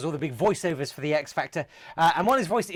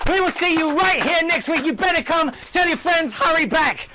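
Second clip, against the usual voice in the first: loud manic; 10 semitones higher, 6 dB louder than usual. Pretend. Manic shouted sign-off.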